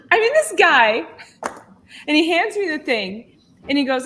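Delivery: usual speaking voice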